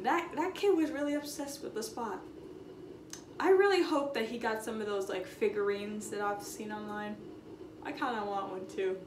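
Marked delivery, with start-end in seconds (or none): none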